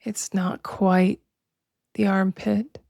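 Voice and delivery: slow, measured meditation voice with long pauses; drawn-out, even-toned relaxation guidance; guided meditation. Acted. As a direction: guide listeners unhurriedly into body awareness.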